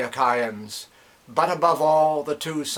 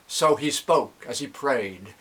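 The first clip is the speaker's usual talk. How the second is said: usual speaking voice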